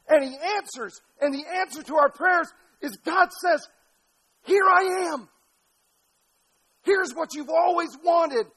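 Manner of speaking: high, loud pulpit climax; impassioned preaching. Real, not acted. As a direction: proclaim God's answer at full force. Preaches climax, voicing God's answer.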